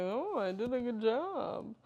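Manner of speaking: put-on a playful doctor's accent, a touch Count Dracula. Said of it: "Alan hates when I make his accent".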